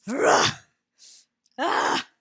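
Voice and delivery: loud imitated rap shouts; aggressive ad-lib. Host imitating the guest's track, aggressive shouts. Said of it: "You sound aggressive".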